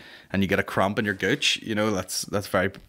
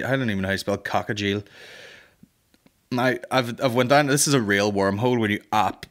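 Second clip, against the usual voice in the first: ordinary talk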